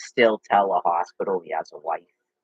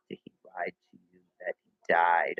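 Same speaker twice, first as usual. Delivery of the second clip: whispered question; 4 semitones lower, 4 dB quieter than usual. Real, not acted. Asks about Brian quietly.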